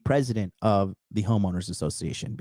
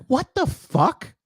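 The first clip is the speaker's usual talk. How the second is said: much higher, slow stunned what the fuck; stunned mock outrage; 14 semitones higher, much slower than usual. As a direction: drag out the shocked question. Mom's shocked reaction retold.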